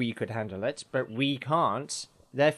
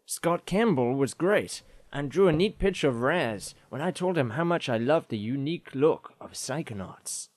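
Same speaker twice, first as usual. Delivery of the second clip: American accent. Shown as a demonstration. Host reads listener email in American accent. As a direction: read it in a hammy American accent. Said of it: "I'll do an American accent".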